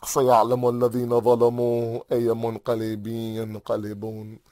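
low, hurried Arabic prayer fading away; solemn closing recitation. Real, not acted. Preacher closes the mourning for Hussein, solemn fading recitation. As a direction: recite low and quick, trailing into near silence.

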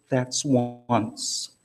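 very soft, low mock threat; quiet warning. Wife to her protesting husband, a hushed warning. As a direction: drop it right down, understated and ominous.

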